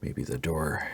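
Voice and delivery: usual speaking voice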